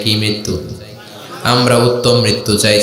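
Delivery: ordinary talk, nothing marked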